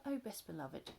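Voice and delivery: ordinary delivery, nothing marked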